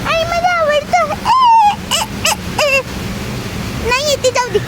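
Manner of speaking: put-on high, slow, pleased child; happy child voice. Telling her mum she likes the rain, a high happy child. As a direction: coo it like a delighted little girl.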